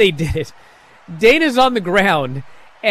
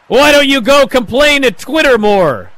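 louder, higher jab at his rival; mocking taunt; 7 semitones higher, 7 dB louder than usual. Miz taunting Ali mid-argument, loud and mocking. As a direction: sneer it out loud like a heel taunting.

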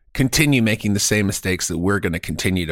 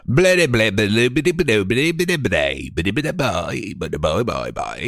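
[name] impression. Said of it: "speak with [name]".

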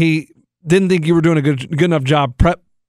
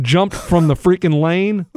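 normal talking voice

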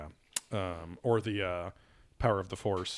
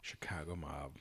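whisper; 7 semitones lower, 17 dB quieter than usual. Pretend. Mock secretive Chicago mob whisper.

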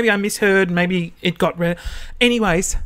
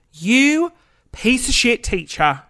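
high drawn-out "you", then slow insult; mock outrage, spacing out the words; indignant; 5 semitones higher, slower than usual. Former student scolding the teacher who favoured the rival, slow indignation.

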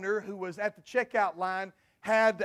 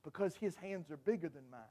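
put-on small boy's answer; 7 semitones lower, 15 dB quieter than usual. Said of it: "He said".

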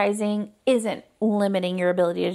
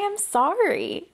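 sincere apology; 8 semitones higher, much slower than usual. Shown as a demonstration. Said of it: "You can just say".

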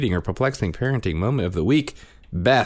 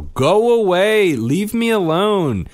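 put-on toddler shooing; 7 dB louder, slower than usual. Toddler shooing dad from potty.

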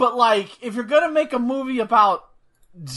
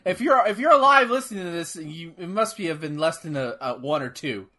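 ordinary talk

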